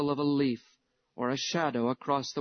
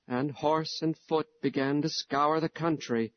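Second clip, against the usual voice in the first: plain reading voice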